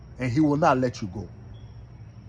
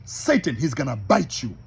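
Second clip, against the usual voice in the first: ordinary delivery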